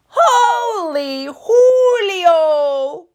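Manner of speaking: very slow, high, loud squeal of surprise; drawn-out high squeal. Acted. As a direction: shriek at the mirror. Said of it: "squealed the kitty boy".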